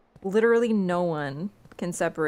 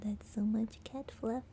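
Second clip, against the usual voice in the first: hushed aside; 9 dB quieter than usual. Real, not acted. Fluff in her face.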